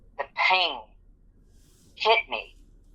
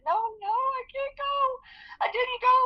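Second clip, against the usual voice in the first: much higher, softer tearful protest; crying; 3 dB quieter than usual. Retold or imitated. Injured worker tells her boss she didn't go, tearful. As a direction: whimper it through tears. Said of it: "I was crying".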